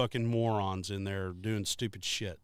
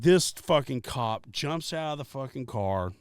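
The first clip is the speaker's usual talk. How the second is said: ordinary talk, nothing marked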